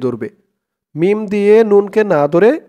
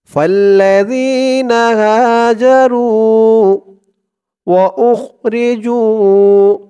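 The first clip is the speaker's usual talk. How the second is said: slow chanted tajweed reading; Quran recitation; 5 semitones higher, 5 dB louder, much slower than usual. Acted. Teacher reciting the verse for students to follow. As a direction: draw out فالذين هاجروا melodically.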